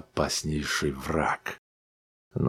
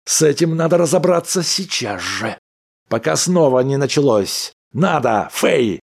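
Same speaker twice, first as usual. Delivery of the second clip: insistent; 8 semitones higher, 4 dB louder than usual. Acted. Husband's angry insistence.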